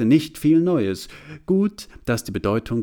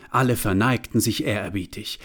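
ordinary delivery

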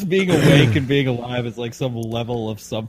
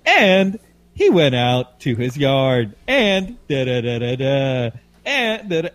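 slow, elongated mock reporter cadence, louder on the opening; Audrey McGlinchey; 3 semitones higher, 4 dB louder, slower than usual. Co-host mocking how a reporter prolongs her story, elongated tone. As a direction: stretch each phrase out, imitating an annoying radio tone. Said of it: "she'll kind of elongate that tone".

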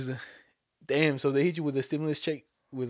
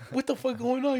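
much higher, confused exclamation; a scammer thrown by the caller; baffled outburst; 10 semitones higher than usual. Retold or imitated. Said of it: "He's like".

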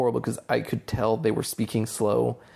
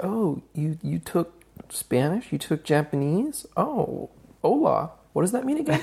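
mock clueless relative; 7 semitones higher than usual. Mocking relatives quizzing about language.

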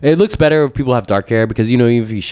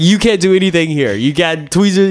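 retold disbelief, pitched up; exasperated protest; 7 semitones higher, 3 dB louder than usual. Patient arguing with the clinic kid, exasperated. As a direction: protest as if this is absurd.

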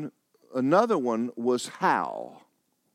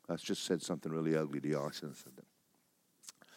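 muttered under the breath, much softer; sheepish aside; 8 semitones lower, 11 dB quieter than usual. Real, not acted. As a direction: drop the voice to a guilty aside. Sheepish aside after insult.

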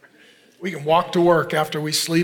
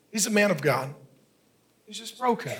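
ordinary talk, nothing marked

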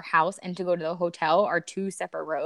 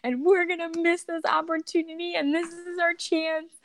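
imitating her own hysterical crying; retold hysterical crying; 11 semitones higher than usual. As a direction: reenact sobbing through the plea. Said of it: "literally hysterical crying".

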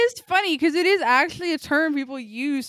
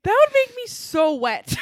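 much higher, loud laughing yell; laughing shout; 9 semitones higher, 8 dB louder than usual. Pretend. Laughing mock line.

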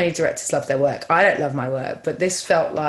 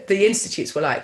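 ordinary delivery

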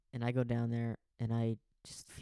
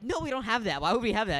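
high, louder mock exasperation; mock exasperated; 10 semitones higher, 8 dB louder than usual. Quoting the roommate's exasperated reply back to her. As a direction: play her fed-up refusal. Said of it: "And you're like".

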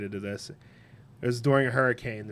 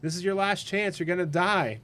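much higher, urgent call; helicopter rescuer calling; 9 semitones higher than usual. Retold or imitated. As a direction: plead with him to climb the ladder. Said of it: "Helicopter comes".

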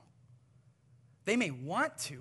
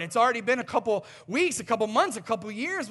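louder, exasperated listing of "weeks", "months", "years"; impatient scolding; 3 semitones higher, 8 dB louder than usual. Retold or imitated. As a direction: tick off the time like an annoyed critic. Imagined dismissive friend continues.

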